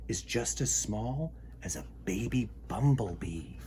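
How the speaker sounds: soft whisper for something tiny. Acted. Reading about the tiny word to kids, whispered. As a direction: whisper it small like a bee.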